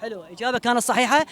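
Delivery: ordinary talk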